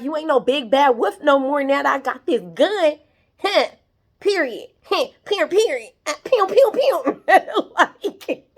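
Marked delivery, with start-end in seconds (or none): 0.0-7.2 taunting gunwoman. Retold or imitated. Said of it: "Basically, like".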